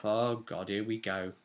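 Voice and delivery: ordinary delivery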